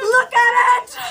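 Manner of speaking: shrill desperate cry to look; distraught cry. Acted. Showing hair falling out, distraught.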